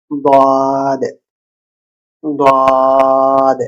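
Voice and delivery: দাদ with a heavy, thick d, drawn out. Shown as a demonstration. Teacher showing the heavy letter দাদ. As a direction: say দাদ slowly and heavily. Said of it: "কিন্তু এটা একটু ভারী হবে".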